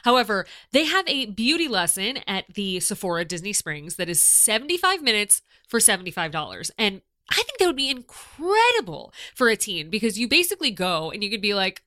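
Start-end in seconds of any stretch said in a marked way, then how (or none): none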